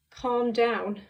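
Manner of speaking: ordinary delivery, nothing marked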